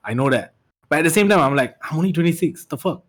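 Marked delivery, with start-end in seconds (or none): none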